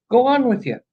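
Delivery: ordinary talk, nothing marked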